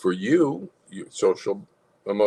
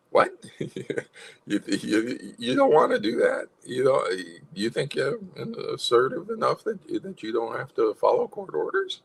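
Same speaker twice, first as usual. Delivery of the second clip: amused, chuckling disbelief, higher; smiling, amused assertion; 5 semitones higher than usual. Shown as a demonstration. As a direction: chuckle through a friendly challenge. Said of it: "And you smile on it".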